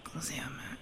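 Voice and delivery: whispered aside. Real, not acted. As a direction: whisper it under your breath. Aside while faking memory loss.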